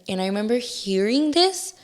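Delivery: ordinary talk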